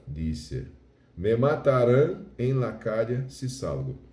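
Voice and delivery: slow, loud Spanish scripture reading; emphatic, drawn-out recitation in Spanish; verse reading. Shown as a demonstration. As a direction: proclaim the verse slowly, each phrase weighted. Reads Spanish Bible verse aloud.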